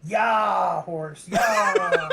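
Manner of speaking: loud, drawn-out rider's cry; shouted horse command. Acted. Player acts spurring on a horse.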